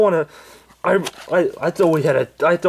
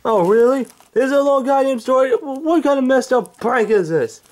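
Angry Grandpa voice; 11 semitones higher than usual. Acted. Old man woken by pranksters, high cranky yelling. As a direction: bark at the intruders like a furious grandpa.

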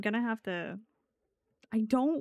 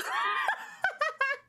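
shrieked cackle; high shrieking laugh. Real, not acted. Loud laugh.

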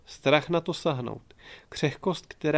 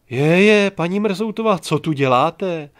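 loud, higher booming exclamation, slowing at the end; rattling boom; 5 semitones higher, 9 dB louder than usual. A narrator's reading. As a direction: burst out over her, slow on the last words. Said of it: "Zarachotil nad ní šílenec čmelda". (hlasité zarachocení, na konci zpomalené)

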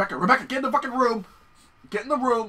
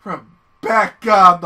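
yelling a name; 2 semitones higher, 15 dB louder, much slower than usual. Real, not acted. Yelling at partner character.

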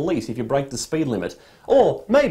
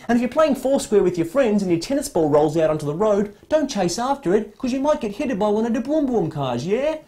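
put-on a light, higher-pitched British accent, like a safety ad for kids; 5 semitones higher than usual. Comic warning road-safety ad for adults, in a British accent. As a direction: talk down to the office workers in a sing-song British accent. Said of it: "Don't know why I put a British accent on then".

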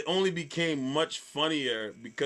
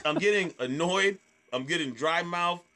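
normal talking voice

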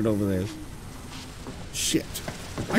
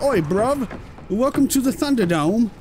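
hammed-up, deliberately poor British accent; 2 semitones higher than usual. Shown as a demonstration. Playing at a British bloke for the viewers, poor British accent. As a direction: put on a bad British accent. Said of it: "more of a poor British accent".

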